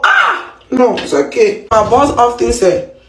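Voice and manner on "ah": squeal. Real, not acted. Squeal after struggling with word.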